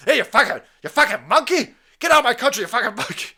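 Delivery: loud, high hammed-up shouting. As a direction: bellow it like the ranting man. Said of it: "But it's".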